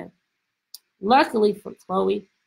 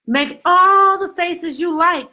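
groaned; 7 semitones higher, 5 dB louder, slower than usual. A narrator's reading. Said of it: "Chloe groaned".

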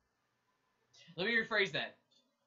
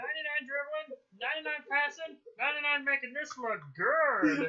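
mock hype; 7 semitones higher, 3 dB louder than usual.

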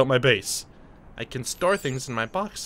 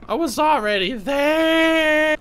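frustrated shout; 5 dB louder, slower than usual. Real, not acted. Frustrated at redundant game objective.

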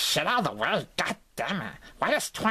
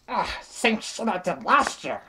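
normal talking voice

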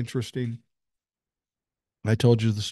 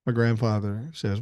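ordinary talk, nothing marked

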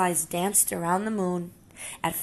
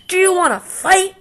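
high, loud taunt with a rising end; bully's dare; 12 semitones higher, 10 dB louder than usual. Acted. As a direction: dare him to fight. Grouchy ladybug picks fight.

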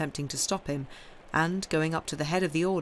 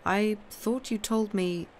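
higher, drawn-out query; puzzled questioning; 5 semitones higher, slower than usual. A narrator's reading. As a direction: question him slowly, pitched up. Franz questions the Count in surprise.

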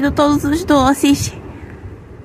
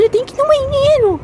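high, fast and urgent; 8 semitones higher, faster than usual. Acted. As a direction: rush it out desperately. Urgent need for bathroom.

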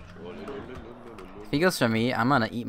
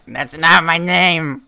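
irritated; 4 semitones higher, 7 dB louder, much slower than usual. Retold or imitated. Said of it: "we just silently like".

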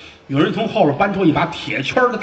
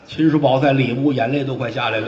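ordinary delivery, nothing marked

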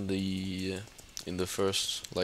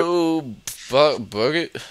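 dismayed outburst; 6 semitones higher, 5 dB louder, slower than usual. Real, not acted. Things going wrong in game.

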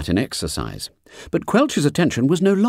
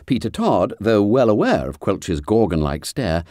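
plain reading voice

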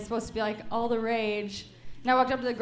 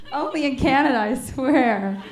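ordinary talk, nothing marked

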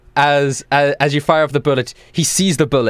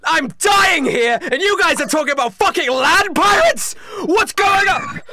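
desperate furious yell; 13 semitones higher, slower than usual. Acted. Dying Trevor yells at crew talking about land pirates, furious. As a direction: scream it while bleeding out.

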